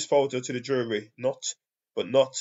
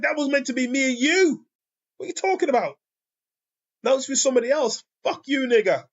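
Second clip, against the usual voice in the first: much higher, louder, fast angry woman's voice; mock jealous woman; 11 semitones higher, 3 dB louder, faster than usual. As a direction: ham up a jealous girlfriend snapping at him. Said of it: "She said".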